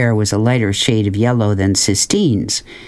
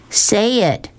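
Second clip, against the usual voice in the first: lightly acted, high insistent order; demanding; 11 semitones higher than usual. A narrator's reading. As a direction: insist on an answer at once. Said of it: "she demanded".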